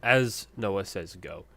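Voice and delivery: ordinary talk, nothing marked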